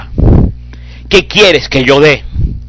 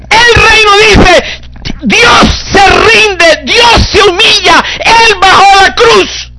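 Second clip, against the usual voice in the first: high, loud, slow preacher's cry; full-force preaching; 3 dB louder, slower than usual. Acted. Preacher cries out religion's plea to the congregation, loud and slow. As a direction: thunder it from the pulpit.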